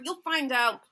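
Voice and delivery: ordinary talk, nothing marked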